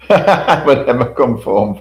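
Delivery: slow words broken by real laughter; laughing while talking. Real, not acted. Vlogger retelling Emma's comeback, laughing through it.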